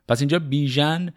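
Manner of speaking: ordinary delivery, nothing marked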